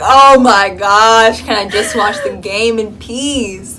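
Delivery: loud, drawn-out mock exasperation; exasperated. Fan wanting to watch the game, hammed-up exasperation. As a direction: wail it slowly, fed up. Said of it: "at this point I was just angry".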